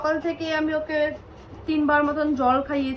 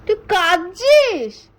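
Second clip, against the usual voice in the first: 1 surprised; 4 semitones higher, 9 dB louder, slower than usual. Real, not acted. Mother sees son crying.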